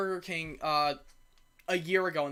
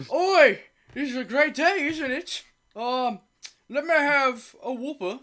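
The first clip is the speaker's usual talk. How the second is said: put-on British accent, higher and slower; 6 semitones higher, 4 dB louder, slower than usual. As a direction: imitate a chirpy British customer at a drive-thru. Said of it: "he had the most British accent ever".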